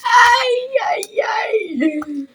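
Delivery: loud high ay ay ay wail; frustrated cry. Real, not acted. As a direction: wail in exasperation. Frustrated outcry.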